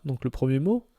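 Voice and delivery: ordinary talk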